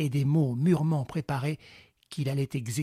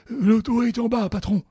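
henchman; 9 semitones higher, 8 dB louder than usual. Acted. Chauffeur reports to boss.

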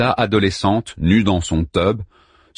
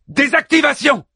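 high, loud commanding roar; loud barked order; 3 dB louder than usual. A narrator's reading. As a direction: roar the command to snap him out. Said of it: "rugit Savi".

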